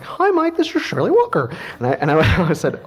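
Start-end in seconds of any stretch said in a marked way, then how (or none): 0.0-1.5 high, small phone voice; little voice. Retold or imitated. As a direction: imitate a tiny high voice on the line. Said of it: "this little voice was like".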